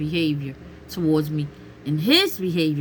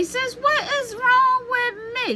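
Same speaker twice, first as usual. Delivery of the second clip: much higher, bewildered voice; high puzzled plea. Retold or imitated. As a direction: squeak it up in disbelief.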